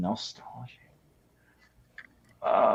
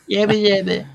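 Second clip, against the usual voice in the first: put-on baby babble; 7 semitones higher than usual.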